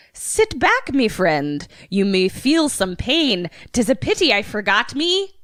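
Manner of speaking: attempted Irish leprechaun lilt; singsong, not quite nailed Irish accent. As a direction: recite a limerick as an Irish leprechaun. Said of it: "I almost said leprechaun accent".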